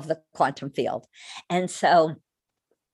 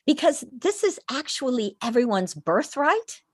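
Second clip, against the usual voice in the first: ordinary talk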